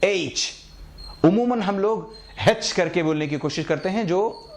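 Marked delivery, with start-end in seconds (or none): 0.0-0.5 "ایچ" as aitch, long vowel, no h in front; correct pronunciation. Shown as a demonstration. Teacher giving the proper letter H, correct pronunciation. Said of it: "اس حرف کی صحیح pronunciation ہے". 2.5-2.7 "ہیچ" with an h in front, hech; wrong pronunciation. Shown as a demonstration. Teacher quoting the common mistake for H, wrong pronunciation. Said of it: "غلط pronunciation ہے".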